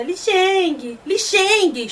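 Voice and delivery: mock tearful plea; retold tearful calling. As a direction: call the name as if crying, lightly acted. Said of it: "chorando, gente".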